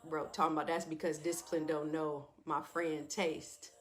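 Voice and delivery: ordinary delivery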